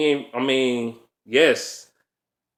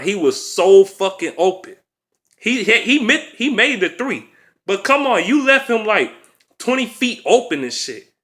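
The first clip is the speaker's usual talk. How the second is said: exasperated rant; 7 semitones higher than usual. Real, not acted. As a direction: vent in disbelief, voice climbing high. Fan ranting about open shooter.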